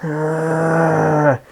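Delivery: very high, loud startled shriek; frightened shriek. Retold or imitated. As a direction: shriek at seeing a ghost. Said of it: "And she's like".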